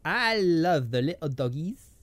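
mock French accent with some South London in it. Cooing over little doggies, South London French accent. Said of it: "That's a sort of South London Frenchie".

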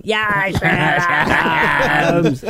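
very slow, loud booming shout. Retold or imitated. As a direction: boom the name out. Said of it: "repeat it back in that voice".

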